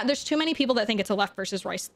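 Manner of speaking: normal talking voice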